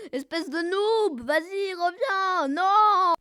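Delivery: loud, wailing insult; taunting yell. Real, not acted. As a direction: holler after an opponent, stretching the words. Yelling at enemy who killed player.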